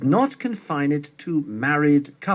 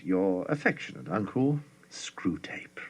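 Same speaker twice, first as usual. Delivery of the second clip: a soft, breathy whisper to close the letter; whispered sign-off; 6 semitones lower, 7 dB quieter than usual. Acted. Screwtape signs off to his nephew in a whisper. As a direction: let the signature fade into a whisper.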